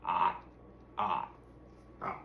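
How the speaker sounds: low frog croaks; frog croaking. A narrator's reading. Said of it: "kept croaking".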